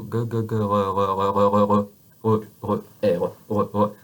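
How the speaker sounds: "g" sliding into a throaty French "r"; from "g" to an uvular "r" without closing. Shown as a demonstration. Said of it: "so you go".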